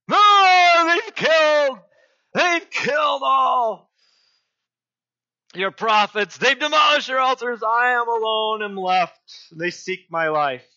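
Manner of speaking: high, loud, distraught cry of complaint; upset Elijah. Acted. Elijah hiding in a cave, crying out to God, distraught. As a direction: wail it like a man at the end of his rope. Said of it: "very upset".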